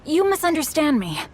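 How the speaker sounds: high, hurried protest; flustered denial. Acted. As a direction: wave off the accusation in a fluster.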